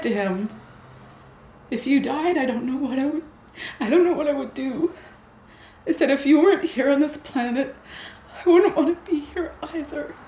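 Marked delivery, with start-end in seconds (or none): 1.7-10.1 choking up. Real, not acted.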